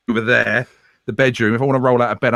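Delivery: ordinary delivery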